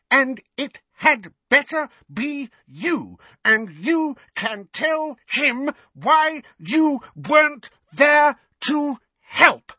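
high, slow, hammering fury; angry old woman. A narrator's reading. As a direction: spell it out angrily. Mrs Figg continues yelling.

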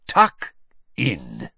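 big, welcoming call to dig in; hall-filling announcement; booming headmaster. A narrator's reading. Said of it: "his deep voice echoing around the hall".

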